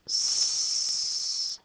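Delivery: French C with cedilla said as a hissed S; the letter sound s. Shown as a demonstration. Teacher modelling the cedilla sound for children, a crisp S. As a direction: hiss the S sound for ç.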